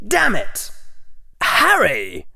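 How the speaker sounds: high, wounded outburst with a superior edge; hurt but still looking down. Acted. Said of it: "sounded wounded and maybe a little superior".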